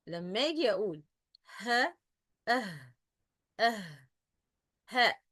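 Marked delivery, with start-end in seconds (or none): 1.6-5.2 breathy Arabic ه sounded alone; Arabic haa demonstration. Shown as a demonstration. Teacher showing students the Arabic letter's sound, Arabic haa demonstration. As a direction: isolate the ه in ها and أه with throaty breath. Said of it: "in Arabic، في اللغة العربية".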